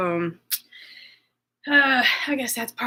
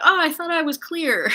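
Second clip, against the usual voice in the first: high, louder dismayed "oh"; surprised realization; 8 semitones higher, 5 dB louder than usual. Retold or imitated. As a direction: caught off guard by her own words. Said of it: "and I thought".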